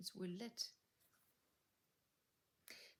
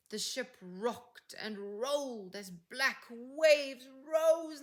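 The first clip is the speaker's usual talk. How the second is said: dramatic storm narration; 3 semitones higher, 7 dB louder than usual. A narrator's reading. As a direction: read the shipwreck like the waves are crashing in. Storm scene, reading builds.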